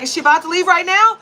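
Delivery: high, loud confrontational question; retold angry demand. Customer re-enacting a demand to the manager, angry and loud. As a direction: demand it loudly, pitch climbing to the end.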